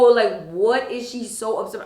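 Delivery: ordinary talk, nothing marked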